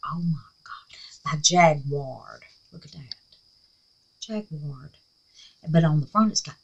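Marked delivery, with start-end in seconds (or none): 0.1-4.9 soft unvoiced whisper of wonder; awed whisper. Real, not acted. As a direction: hush it like a secret treasure. Whispering in awe at paints.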